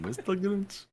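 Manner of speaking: high movie quote. Retold or imitated. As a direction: squeak the Grinch line. Quoting movie again.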